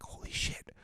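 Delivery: whispered disbelief; whispered shock at the news; stunned whisper. Retold or imitated. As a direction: gasp it under your breath. Inner voice stunned.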